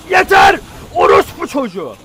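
a high, loud screamed curse; real rage-quit yell; furious shout. Real, not acted. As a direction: lose it completely at the screen. Raging after being killed.

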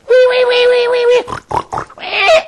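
very high squealing wee wee; piggy squeal. Acted. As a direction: squeal like a fleeing piglet. Pigs running away squealing.

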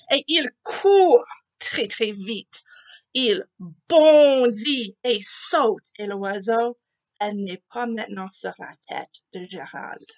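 usual speaking voice

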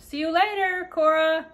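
high, loud excited call. Acted. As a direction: shout a gleeful farewell from above. Gus calling while flying off.

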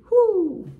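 high, sudden "whoo"; exultant whoop. Real, not acted. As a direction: let out a high whoop of praise. Exclaims after praising God Almighty.

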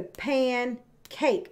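pancake slowly tapped out as pan, cake; two separate beats on pancake, pan and cake; tapped-out syllables. Shown as a demonstration. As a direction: break pancake after pan. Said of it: "if you're tapping that out".

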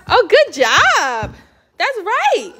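slow, high, drawn-out praise. Real, not acted. Mom praising her toddler.

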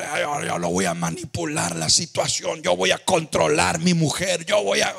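mock his boastful self-assurance; a lightly voiced know-it-all husband; mocking a controlling husband. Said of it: "oh, sí, tiene tanto control".

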